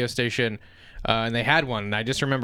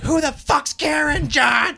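shrieking yell, much higher and louder; furious shout; 14 semitones higher, 5 dB louder, slower than usual. Retold or imitated. Wife on radio screaming at husband. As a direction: scream the accusation at the cheating husband.

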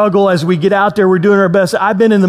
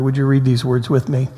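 ordinary talk

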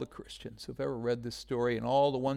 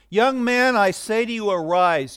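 loud, raised command to rise; commanding call; 6 semitones higher, 8 dB louder than usual. Retold or imitated. Jesus commanding the dead man to arise, loud. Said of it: "Jesus said".

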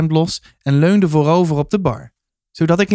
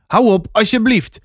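hoger, dringend verzoek om te stoppen; smekend bevel; 6 semitones higher than usual. A narrator's reading. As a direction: roep licht geërgerd tot de orde. Man scolds excited dog. (dringend smekend)

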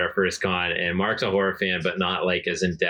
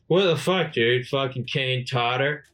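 imitating drunk Mark, high and loose; a hammed-up drunk buddy slurring the name; drunk slur; 4 semitones higher, slower than usual. Retelling drunk Mark mangling a name, mock drunk slur.

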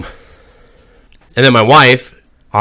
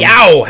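high, loud yelp; yowl after drink; 8 semitones higher, 5 dB louder than usual. Real, not acted. Drinker reacting to a strong pour, yelping. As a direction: yelp at the kick of the rum.

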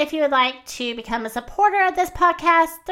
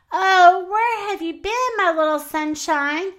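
tender relief; 7 semitones higher, 4 dB louder than usual. A narrator's reading. Mother Hen greets found chick.

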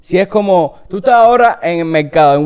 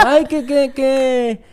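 high-pitched, stumbling mock admiration; imitated Mexican admirer; 7 semitones higher than usual. Mexicans charmed by the Cuban accent, imitated high gushing. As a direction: gush like a Mexican listener, voice pitched up. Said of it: "Los mexicanos".

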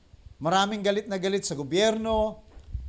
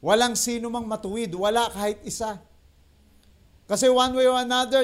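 ordinary talk

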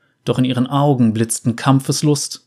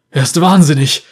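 gasping; 4 semitones higher, 5 dB louder than usual. A narrator's reading. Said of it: "keuchte einer der Reiter".

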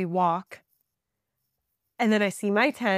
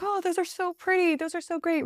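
much higher gushing over pretty clouds; mock gushing; 9 semitones higher than usual. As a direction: gush naively before the storm hits. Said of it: "we're just like".

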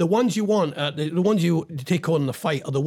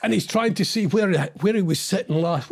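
ordinary talk, nothing marked

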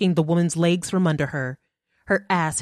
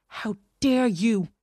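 growled; 5 semitones higher, slower than usual. A narrator's reading. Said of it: "Natalie growled".

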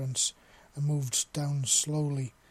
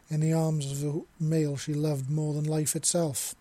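ordinary delivery, nothing marked

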